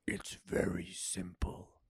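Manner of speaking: slow, low whisper; soft whispered reassurance; soothing goddess. A narrator's reading. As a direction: explain it in a slow hush. Goddess soothes him softly.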